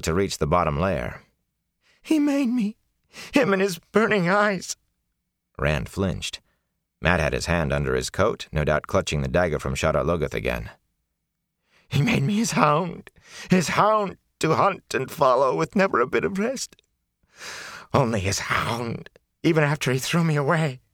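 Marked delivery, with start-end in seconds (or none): 2.0-4.8 crying. Acted. Filthy prisoner breaking into tears before the lord, high and tearful. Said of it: "Fain began to cry". 11.9-20.8 crying. Acted. Filthy prisoner breaking into tears before the lord, high and tearful. Fain weeps about his master.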